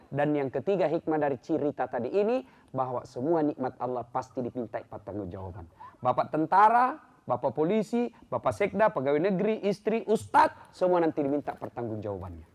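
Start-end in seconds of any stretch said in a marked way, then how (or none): none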